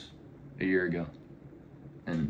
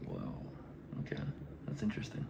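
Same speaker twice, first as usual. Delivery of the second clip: whispering; 12 semitones lower, 12 dB quieter than usual. Retold or imitated. Retold reaction to awkward talk.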